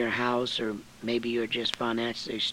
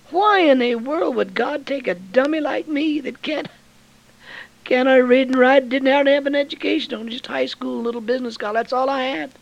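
high and loud, marvelling at himself; impassioned preaching; 13 semitones higher, 8 dB louder than usual. Acted. Preacher to the church about being chosen, loud and high. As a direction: cry it out from the pulpit. Preacher recalls his own calling.

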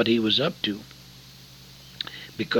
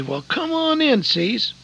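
Darrell's welcome; 12 semitones higher than usual. Retold or imitated. Darrell welcoming him at night.

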